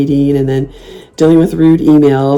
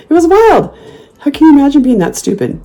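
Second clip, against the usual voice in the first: squeaky high disbelief; high-pitched amazed exclaim; incredulous; 11 semitones higher than usual. Real, not acted. Laughing disbelief.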